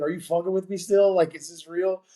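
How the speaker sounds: high, excited can't-believe-it voice; stunned disbelief. Retold or imitated. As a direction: relive the shock of hearing you got in. Retold stunned disbelief on phone.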